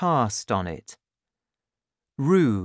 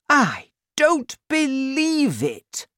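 very high, loud, slow disbelief; outraged disbelief, drawn out; cried out; 4 dB louder, slower than usual. A narrator's reading. Doc Spencer crying out at the father's story, stunned. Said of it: "cried Doc Spencer".

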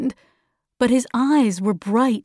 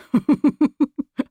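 staccato mocking laugh; villain's laugh; 4 semitones higher than usual. A narrator's reading. The voice laughing at the children, staccato villain's laugh. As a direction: laugh in short separate bursts. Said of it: "They heard another laugh".

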